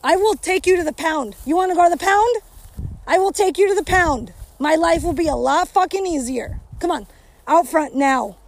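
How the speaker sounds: loud, high, exasperated threats to the dog; angry yelling at the dog. Real, not acted. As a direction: yell furiously at a disobedient dog.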